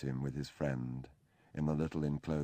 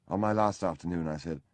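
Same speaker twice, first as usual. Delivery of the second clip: plain reading voice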